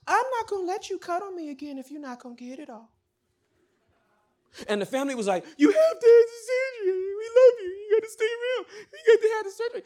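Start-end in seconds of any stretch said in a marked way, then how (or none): 0.1-2.9 high, fast, light imitation; patient turning down surgery, high and airy; Cookie's voice. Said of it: "Cookie had a very light and airy voice". 5.6-9.8 high, loud, crying plea; family begging her to have surgery, crying; tearful pleading. Retold or imitated.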